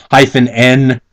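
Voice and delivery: usual speaking voice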